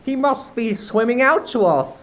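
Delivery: high, loud puzzled pirate. Acted. Starkey to Smee, a high pirate voice. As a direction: wonder where the captain is. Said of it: "Starkey said".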